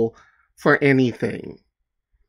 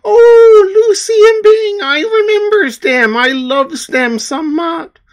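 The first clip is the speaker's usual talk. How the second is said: high, crying Newfoundland mum voice, much louder; imitating mother; 7 dB louder than usual. Son imitates his mother gushing over his comic, high and teary. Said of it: "that was my mother in Newfoundland going".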